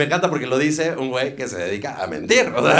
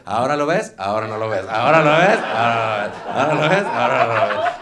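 mock magician patter; 5 dB louder than usual. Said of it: "se cansó de jugar al así de".

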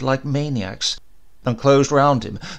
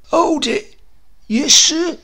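much higher, loud sudden halt; clerk; 8 dB louder than usual. Acted. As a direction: stop short for the boss. Clerk halts, greets boss.

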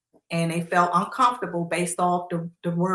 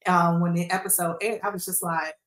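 usual speaking voice

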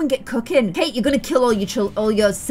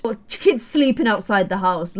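ordinary talk

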